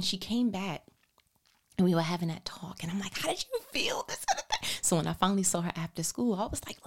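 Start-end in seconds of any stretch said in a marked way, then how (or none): 3.2-4.8 much higher, laughing ask; gentle high question. Retold or imitated. Gentle question to her daughter.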